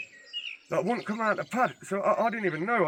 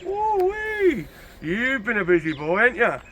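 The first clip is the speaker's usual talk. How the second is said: high drawn-out "woo-wee", then slower teasing; gleeful whoop; 5 semitones higher, 4 dB louder, slower than usual. Retold or imitated. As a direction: crow over the haul in a retold whoop. Gloating over loot in retold robbery.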